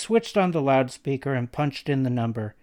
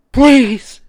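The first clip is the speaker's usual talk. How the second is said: high, loud terrified plea; desperate begging; 13 semitones higher, 15 dB louder than usual. A narrator's reading. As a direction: beg for your life. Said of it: "the woman begged".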